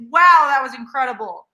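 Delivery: ordinary talk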